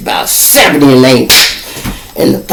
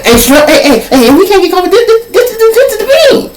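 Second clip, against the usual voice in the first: very high, squeaky panic; imitating a frightened whiny warning; panicky squeal. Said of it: "he had to remind me".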